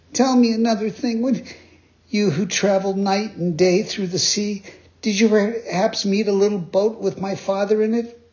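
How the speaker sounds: high, earnest puppet voice; Pinocchio voice. Acted. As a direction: plead earnestly, high-pitched. Pinocchio asks after his father.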